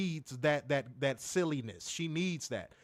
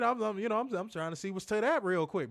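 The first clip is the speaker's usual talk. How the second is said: ordinary talk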